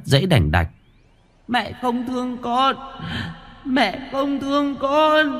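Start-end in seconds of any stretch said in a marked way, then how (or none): 1.5-2.8 put-on high, soft baby-like wail; a ghost child sobbing at its mother. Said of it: "Nó khóc như là tiếng đứa trẻ sơ sinh". 3.6-5.4 put-on high, slow, stretched baby wail; drawn-out ghost wail. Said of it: "Nó khóc như là tiếng đứa trẻ sơ sinh".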